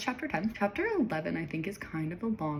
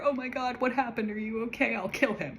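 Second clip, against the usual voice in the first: high, hammed-up alarm; imitating a frantic overprotective reaction; mock protective panic; 6 semitones higher than usual. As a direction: gush with exaggerated concern, pitched well up. Acting out Ryder's sudden protective panic.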